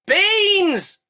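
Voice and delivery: high, loud repeat of Beans!; Beans! yelled up the pipe. Acted. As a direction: shout Beans! again so she hears. Repeated louder.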